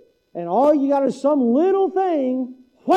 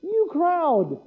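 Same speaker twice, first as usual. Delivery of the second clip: "You crowd" higher, in a hammed-up Southern drawl; 6 semitones higher than usual. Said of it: "Who says that?".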